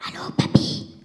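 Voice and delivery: whispered phone answer; tinny talking telephone. Pretend. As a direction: breathe it into the phone like a child. Petite-fille répond au téléphone, jeu. (chuchoté au téléphone)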